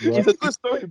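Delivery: normal talking voice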